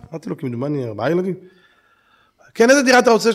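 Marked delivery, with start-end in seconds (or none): 2.5-3.3 higher, louder sales clerk greeting; salesman voice. Retold or imitated. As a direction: greet the buyer like a slick salesman. (מוכר חלקלק בקול גבוה)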